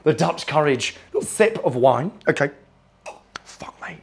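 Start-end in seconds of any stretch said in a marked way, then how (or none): 3.4-4.0 whisper. Real, not acted. After a sip of wine.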